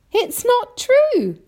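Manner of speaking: very high, loud, drawn-out disbelief; incredulous boy. A narrator's reading. James disbelieves the grasshopper's claim, loud and incredulous. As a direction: stretch the denial up high.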